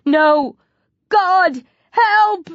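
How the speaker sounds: high, loud cries of terror; screaming. A narrator's reading. Boy attacked by zombies, screaming. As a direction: scream for rescue in separate bursts. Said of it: "Mark screams".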